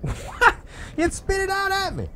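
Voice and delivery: high, laughing disbelief; surprised laugh. Real, not acted. Gamer startled when the folder spits back, laughing high. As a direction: caught off guard by a stubborn folder.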